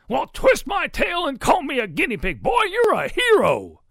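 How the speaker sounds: high, booming folksy bluster; astonished Papa pig. A narrator's reading. Papa pig hails Ruby as hero, booming. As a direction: shout your amazement, building.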